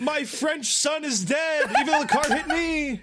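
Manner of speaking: hammed-up wail through laughter, high and slow; mock grieving father. Parody father lamenting his French son, laughing. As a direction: ham up a dying son's father, laughing. Mocking the game's death scene.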